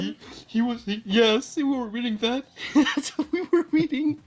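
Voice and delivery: high-pitched, laughing through the words. Real, not acted. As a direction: crack up while trying to talk. Cracking up while confirming the story.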